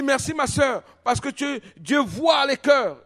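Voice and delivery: high, drawn-out retold thanks; high voice, stretching the last words. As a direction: call your thanks across a crowd, pitch up. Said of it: "Je parle comme ça".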